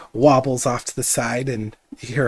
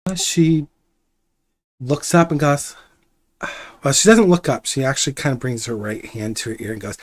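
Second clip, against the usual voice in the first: normal talking voice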